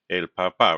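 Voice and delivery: "papa" as pa-PA, last syllable stressed; shifted final stress. Shown as a demonstration. Teacher repeats the accented word for students, final-syllable stress on "papa". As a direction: put the weight on the second pa of "papa". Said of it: "It's shifted stress".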